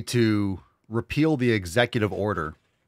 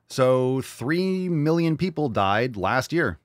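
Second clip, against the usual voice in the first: slow emphasis; much slower than usual. Real, not acted.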